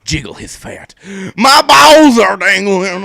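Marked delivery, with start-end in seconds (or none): 1.4-3.0 loud, slow goofy Ed line; hammed-up giggly Ed. Friend reading fanfic aloud, loud goofy Ed voice. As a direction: play it silly and loud. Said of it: "Ed giggled".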